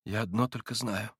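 hushed; near whisper. A narrator's reading. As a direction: barely breathe it. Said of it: "почти шёпотом проговорил Алёша".

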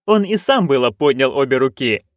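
ordinary delivery, nothing marked

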